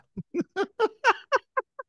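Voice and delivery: cracking up in a squeal; high laugh. Real, not acted. Game master laughing at the joke, high and bursting.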